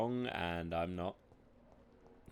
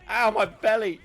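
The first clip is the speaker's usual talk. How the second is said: mock pain yell; 11 semitones higher, 9 dB louder than usual. Yelling in pain at game.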